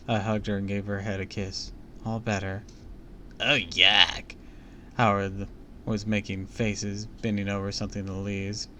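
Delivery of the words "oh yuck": disgusted. Acted. Kid disgusted by worms.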